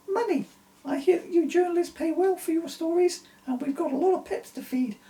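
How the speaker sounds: squeaky high silly voice, kept up. A narrator's reading. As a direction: stay in the daft wife voice. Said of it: "I can't do that voice".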